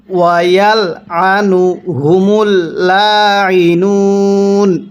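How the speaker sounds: slow, drawn-out tajweed chanting; slow Quran recitation. Shown as a demonstration. Teacher demonstrating recitation to students, slow chant. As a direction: recite each syllable long and measured. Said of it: "এরকমভাবে শিখব".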